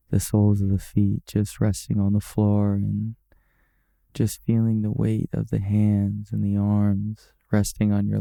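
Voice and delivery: calm, even-pitched meditation guide; guided meditation. Acted. Guiding OCD beginners through breathing and body scan, calm meditation voice. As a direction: guide them gently, unhurried, with long settling pauses.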